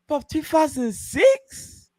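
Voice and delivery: slow, high incredulous question; disbelieving. Real, not acted. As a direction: drag it out in disbelief. Realising the year, disbelief.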